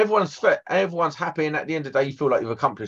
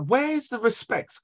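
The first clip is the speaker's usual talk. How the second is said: indignant; 10 semitones higher, slower than usual. Retold or imitated. Indignant quote to site managers.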